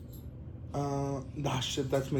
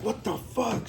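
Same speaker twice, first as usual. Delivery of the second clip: high-pitched yell without the volume; held-back mock yell; 8 semitones higher, 4 dB quieter, slower than usual. Retelling what the neighbors surely heard, held-back mock yell. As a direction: imitate yelling, pitched up but not loud. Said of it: "'cause I yelled loud".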